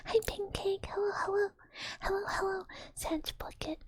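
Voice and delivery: high sing-song greeting to a pet. Acted. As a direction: coo at the pet.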